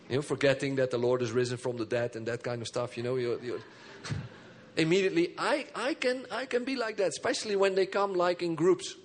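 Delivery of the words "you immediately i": ordinary talk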